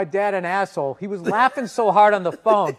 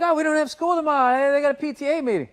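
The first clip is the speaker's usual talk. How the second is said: put-on excited kids; 7 semitones higher, faster than usual.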